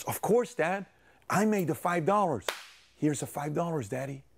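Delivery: put-on high childlike assurance, easing off at the end; eager fibbing son. Son hands dad mom's money, falsely assuring him. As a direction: play an innocent kid proudly reporting.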